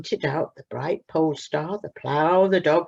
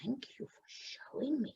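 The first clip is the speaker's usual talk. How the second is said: whisper; 8 semitones higher, 15 dB quieter than usual. Acted. Plop thanks the cat.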